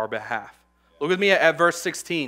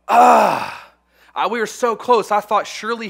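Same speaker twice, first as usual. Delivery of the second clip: frustrated outcry; 7 semitones higher, 8 dB louder than usual. Real, not acted. Anguish at missed deadline.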